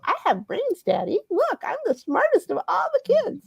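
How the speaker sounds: put-on high, soft girlish voice; mocking a daughter showing off to Daddy; mocking daddy's girl. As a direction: play the smug favourite daughter, high and sweet. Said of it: "her favorite prop to show".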